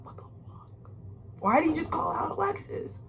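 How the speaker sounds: hushed, stunned whisper; whispered shock. Retold or imitated. Onlookers at the bedside, whispering in shock. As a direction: breathe it out under your breath.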